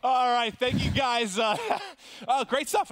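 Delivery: high, drawn-out words through laughter; real laughter lifting the voice high; amused, laughing. Real, not acted. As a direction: hand back while cracking up. Host cracking up after interview.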